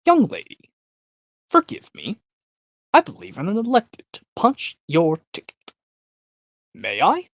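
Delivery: conductor impression. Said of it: "Eric goes".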